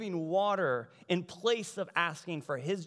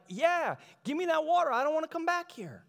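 high, loud burst of eagerness; eager acceptance; 10 semitones higher, 4 dB louder than usual. Acted. Preacher acts woman desperate to skip the well, excited. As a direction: jump at the offer, pitched way up.

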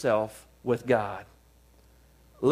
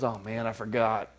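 mock reluctant God; 6 semitones lower, 3 dB quieter than usual. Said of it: "And then he goes".

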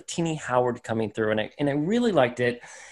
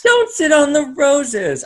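much higher, slow, sneering; haughty villainess voice; 13 semitones higher, 5 dB louder than usual. Acted. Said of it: "the accent or voice for Saturn nine".

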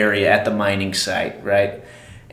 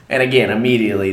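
usual speaking voice

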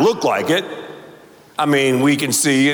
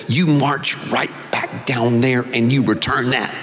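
a hint of a stern Mississippi mom, lower; lightly voiced mother giving an order, lower; 6 semitones lower than usual. Mom ordering her son to return the toy, lower and stern. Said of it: "she said".

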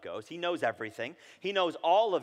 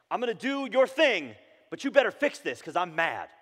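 mock demanding; 5 semitones higher, 6 dB louder than usual. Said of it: "putting God in a position saying".

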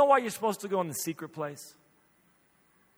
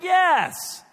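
high, loud mock-exasperated yes; acted-out husbandly impatience; exasperated insistence; 14 semitones higher, 8 dB louder than usual. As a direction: insist loudly, pitched way up. Husband insists to doubting wife.

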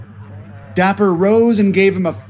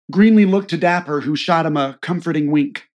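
usual speaking voice

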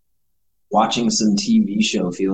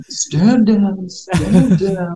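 high, louder hammed-up cry of stand down; mock panicked order; 10 semitones higher, 5 dB louder, much slower than usual. Co-host voicing Hayward with guns pointed at him, mock panic. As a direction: beg the soldiers to back off in a comic panic.

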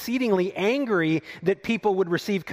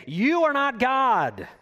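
higher, forceful rebuke of Jonah; God sternly correcting Jonah, pitched up and firm; 5 semitones higher than usual. Retold or imitated. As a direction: call him out, firm and rising. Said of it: "the Lord is calling Jonah out".

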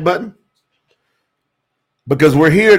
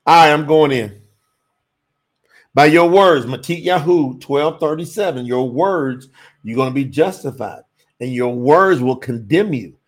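ordinary talk, nothing marked

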